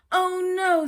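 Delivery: loud, high groan of frustration; exasperated Mister Bear. Acted. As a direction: cry it out like a sleepless bear at the end of his rope.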